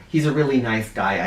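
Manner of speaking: plain reading voice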